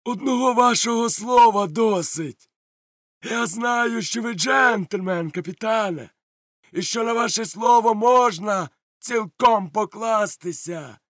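high, slow booming praise; hearty pirate flattery. Acted. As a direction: lay the flattery on thick. Silver flatters the captain.

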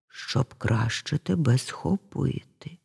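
slow, soft, low reply; disguised wolf. A narrator's reading. Wolf posing as grandmother to the girl, disguised and low. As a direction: answer low and slowly, holding back.

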